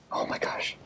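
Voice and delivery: hushed horrified whisper. Real, not acted. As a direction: whisper it, appalled at himself. Horrified at hitting pet.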